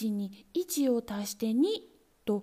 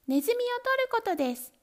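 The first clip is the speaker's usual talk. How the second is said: impatient kittens, much higher; 11 semitones higher than usual. A narrator's reading. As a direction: shout it back at once. Kittens answer.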